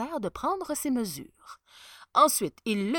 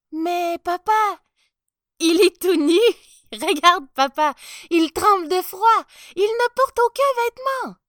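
put-on high, loud child's exclamations; astonished little boy; 10 semitones higher, 12 dB louder than usual. A narrator's reading. Little boy telling papa the emperor is naked, amazed. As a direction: blurt it out as an amused child.

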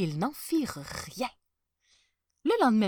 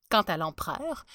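usual speaking voice

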